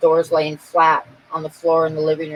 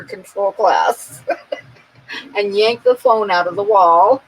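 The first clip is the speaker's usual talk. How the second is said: slow words breaking into laughter; laughing; 2 semitones higher than usual. Real, not acted. Herself to friends, laughing while telling.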